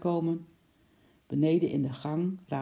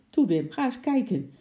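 higher, louder urging; urging call; 7 semitones higher, 4 dB louder than usual. Acted. Wife urges Wim to check the car, higher urging. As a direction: push him to go look. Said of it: "riep de mevrouw".

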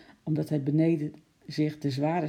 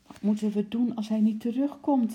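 put-on worried, higher-pitched child's question; unhappy; 6 semitones higher than usual. A narrator's reading. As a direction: ask it anxiously, a little miserable. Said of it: "fluisterde Lotje ongelukkig". (ongelukkig en bezorgd)

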